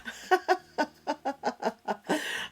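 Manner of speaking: long, high peal of laughter. Real, not acted. Hearing the brand name mystery tin, delighted laughter.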